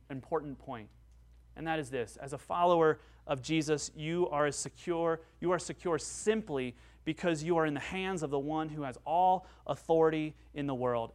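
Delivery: normal talking voice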